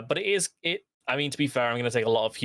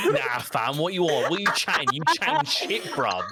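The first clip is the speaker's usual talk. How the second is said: put-on British chav, pitch climbing midway; chav accent; 3 semitones higher than usual. Shown as a demonstration. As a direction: do a mock-confrontational British chav. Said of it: "when I start speaking like a chav".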